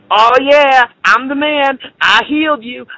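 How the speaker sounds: high, boastful bragging voice; Jesus as a smug show-off, voiced high; mock cocky Jesus. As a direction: brag like a show-off claiming all the credit. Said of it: "He didn't say".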